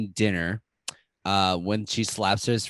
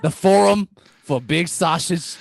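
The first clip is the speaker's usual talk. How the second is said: loud, higher New York Italian brag; New York Italian accent; 6 semitones higher, 5 dB louder than usual. Acted. Staten Island character boasts about his sausage, loud New York Italian accent. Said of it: "I'm done with Staten Island".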